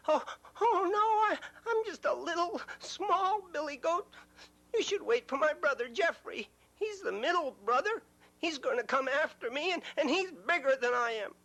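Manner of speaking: very high, fluttering plea; scared little goat. Acted. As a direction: beg nervously in a tiny voice. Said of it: "small goat pleads".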